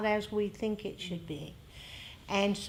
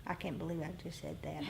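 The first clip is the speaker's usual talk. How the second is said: much softer and fast, tossed off under the breath; quick embarrassed aside; 4 semitones lower, 12 dB quieter, much faster than usual. Real, not acted. Catching herself after a blunt remark, a fast soft aside. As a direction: mutter it quickly as if to yourself.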